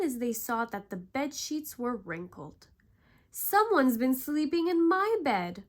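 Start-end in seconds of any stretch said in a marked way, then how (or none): 3.3-5.6 loud, higher roar; Papa Bear roaring. A narrator's reading. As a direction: roar it out as the big angry bear. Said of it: "Papa Bear roared".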